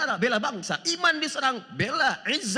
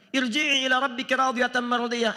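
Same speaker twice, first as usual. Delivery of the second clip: Quran recitation; 4 semitones higher than usual. Acted. Preacher reciting the verse to the congregation, chanted. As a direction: intone it like tilawah.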